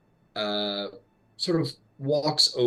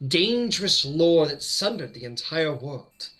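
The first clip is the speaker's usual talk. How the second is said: grave question, higher and slowing toward the end; challenging Grand Mage; 4 semitones higher than usual. Acted. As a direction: challenge him with one weighty final question.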